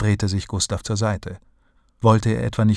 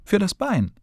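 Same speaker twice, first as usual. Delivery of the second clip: puzzled question; 12 semitones higher than usual. A narrator's reading. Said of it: "zog fragend die Stirn kraus".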